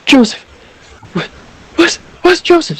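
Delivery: climbing, desperate calls for Joseph; panicked mother searching, pitch rising each call; frantic calling. Acted. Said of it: "shouting and screaming out".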